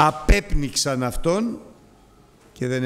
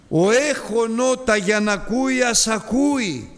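raised, higher proclaiming call; 8 semitones higher, 3 dB louder than usual. Acted. Preacher voicing Jesus calling out to the crowd, higher and louder. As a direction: cry it out to the crowd. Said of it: "φώναζε".

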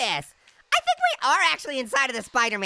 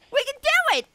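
excited cheer; 12 semitones higher than usual. Real, not acted. Rallying to run.

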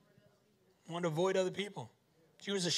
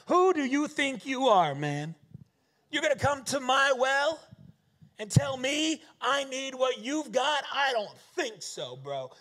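louder, higher defiant woman; calling him out; 6 semitones higher, 4 dB louder than usual. Acted. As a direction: put him in his place. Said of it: "She is straight calling him out".

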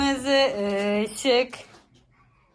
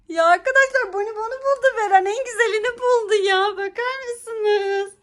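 high delighted exclamation; higher, thrilled voice to viewers; excited; 8 semitones higher than usual. Real, not acted. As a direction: gush over the find. Delighted at the child's find.

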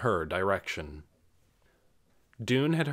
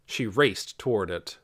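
usual speaking voice

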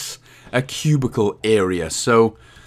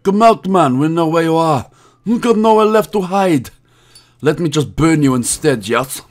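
hammed-up mobster accent, a little higher; mock Mafia gangster; 3 semitones higher than usual. Player taunting a hidden spider, mock Mafia accent. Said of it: "feeling very much like the Mafia".

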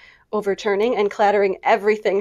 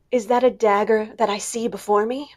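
tragedian mutter. Acted. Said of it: "muttered Jo".

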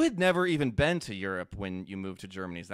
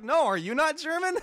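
even higher, loud mimicked disbelief; astonished counter-question; 5 dB louder than usual. Other learner turning the question back, imitated high astonishment.

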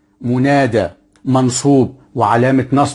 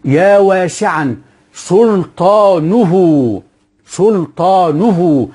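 higher, very slow يا واسعًا سلطانه with سلطانه stretched; slow drawn-out example; 6 semitones higher, much slower than usual. Shown as a demonstration. Teacher says listen, then stretches the example. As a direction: drag out سلطانه syllable by syllable. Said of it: "اسمع".

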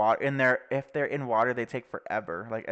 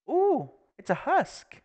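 excited; 4 dB louder, slower than usual. Real, not acted. Spots a new mob.

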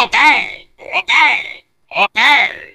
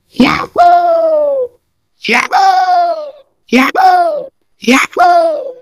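higher, whooping cartoon cheer; joyful yahoo; 6 semitones higher, slower than usual. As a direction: leap for joy with it. Repeated jubilant game cry.

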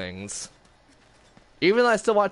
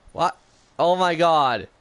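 surprised; 5 semitones higher, 4 dB louder than usual. Real, not acted. Surprised at chaos.

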